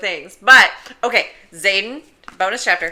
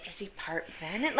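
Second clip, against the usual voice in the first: soft unvoiced whisper; 14 dB quieter than usual. Real, not acted. Whispering a wild theory about Dane to the cohost.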